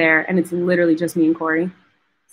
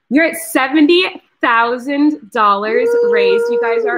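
high, slow, amazed announcement; can't believe the total, let it lift; thrilled reveal of the donation total; excited; 8 semitones higher, 4 dB louder, slower than usual. Real, not acted. Announcing big fundraiser total live.

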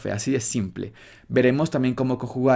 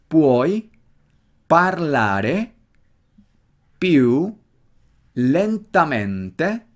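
slow Italian, a pause after every word; word-by-word, marked accent; 3 semitones higher, 4 dB louder, much slower than usual. Shown as a demonstration. Teacher drills the Italian question, slow and word by word. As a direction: break it into single words, each accent marked. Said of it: "Nota el acento bien marcado".

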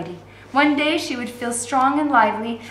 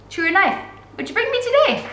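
lightly voiced guard; 11 semitones higher than usual. A narrator's reading.

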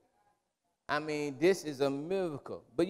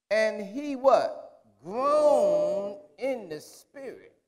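rising, louder preaching cadence; 6 semitones higher, 6 dB louder, slower than usual. Acted. Preacher reading Lazarus story to congregation, building. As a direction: climb into the text with fervor.

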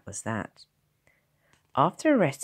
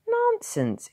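high, loud protest; expostulating; 6 dB louder than usual. A narrator's reading. Doctor's wife objecting to her husband, expostulating. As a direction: dismiss it sharply, pitched way up. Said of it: "expostulated Missus Little huskily".

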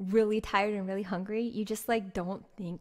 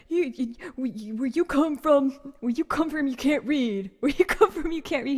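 loud, higher, slurred repeated taunt; mocking drunk yell; 4 semitones higher, 7 dB louder than usual. Drunk man yelling back at [name] on the bus, loud taunting. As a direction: bark it over and over like the drunk guy. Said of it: "he's just yelling back at me".

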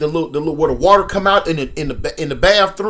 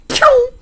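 zoom sound effect; 6 dB louder than usual. Retold or imitated. Running out the door.

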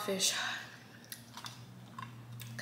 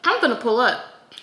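louder, emphatic; 3 semitones higher, 9 dB louder than usual. Shown as a demonstration. Telling viewers her plan, louder and hyped. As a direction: announce it like you mean it.

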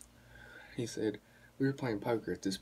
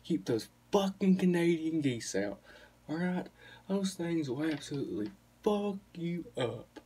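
higher, emphatic stand-up rant; 5 semitones higher than usual. Retold or imitated. Retelling a comedian's rant about Canadian geese, emphatic impression. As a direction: rant it like a comic, stretching the key words. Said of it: "He was like, he said".

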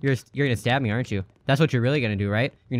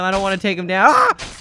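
ordinary delivery

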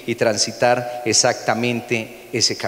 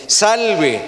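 higher, louder mock salute; mocking hail; 7 semitones higher, 5 dB louder than usual. A pastor reading soldiers mocking Jesus, jeering. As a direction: jeer it like a soldier. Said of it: "se burlaban diciendo".

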